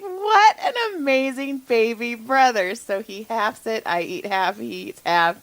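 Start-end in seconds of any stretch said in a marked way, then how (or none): 0.0-2.7 mock-tearful gratitude. Sarcastic gratitude, retold.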